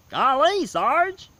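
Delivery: high, loud hokey cartoon voice; goofy sidekick voice. To the viewers, a hammed-up goofy soldier voice. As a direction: squeal it like an old-time cartoon sidekick.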